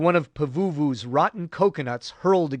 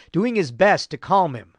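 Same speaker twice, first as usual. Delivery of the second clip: plain reading voice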